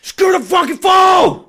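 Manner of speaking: high, drawn-out scream; full-volume screeching outburst; retold furious yell. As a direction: scream it at an exasperating sister. Retold yelling at sister.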